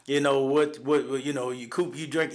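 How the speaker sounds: ordinary talk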